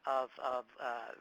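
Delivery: ordinary talk, nothing marked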